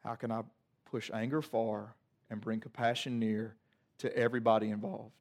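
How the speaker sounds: low, soft voice of God choosing mercy; calmed after a breath. Acted. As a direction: let the breath out, then speak softly. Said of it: "catch a breath".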